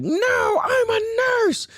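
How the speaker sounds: very high mock shout; hammed-up screech pulling rank; indignant old lady. Mocking the self-declared nurse, indignant screech. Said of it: "this fucking old lady".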